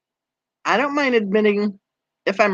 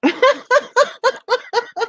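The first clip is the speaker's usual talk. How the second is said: high, helpless laughter. Real, not acted. Laughs at viewer's comment.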